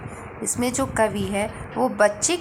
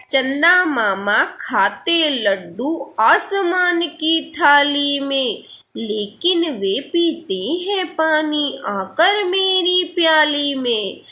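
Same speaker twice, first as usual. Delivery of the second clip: slow, lilting verse with long-held vowels; poem recitation; 4 semitones higher, slower than usual. Acted. Teacher reading the next verse to pupils, slow recitation. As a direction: recite it slowly, stretching each phrase.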